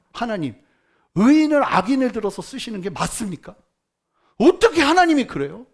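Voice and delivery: loud, indignant cry at God; angrier protest. Retold or imitated. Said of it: "하박국이 더 화가 나요".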